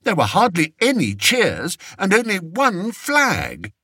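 high, fretful king voice; a spoiled king fishing for comfort; vain fretful king. A narrator's reading. Fred frets.

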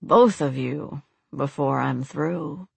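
low, slow, grinning threat; grinning menace. A narrator's reading. Priest threatening both captive women, grinning. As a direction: draw it out with a sinister grin. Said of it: "the priest grinned".

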